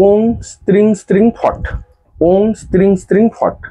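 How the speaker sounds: high, measured recital of "ওং স্ত্রিং স্ত্রিং ফট"; mantra pronunciation demo. Shown as a demonstration. Astrologer showing viewers how to pronounce the mantra, high careful recital. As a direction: pronounce "ওং স্ত্রিং স্ত্রিং ফট" high, each syllable distinct. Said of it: "আমি মুখেও বলে দিচ্ছি".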